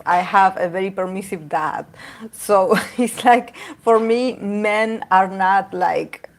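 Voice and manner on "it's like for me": usual speaking voice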